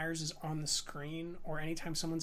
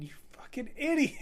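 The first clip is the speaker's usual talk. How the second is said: imitating a heckler, higher-pitched; retold crowd shout, pitched up; mock shouting; 9 semitones higher than usual. Audience heckles the guy getting out of the car, mock shout. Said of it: "everyone starts shouting".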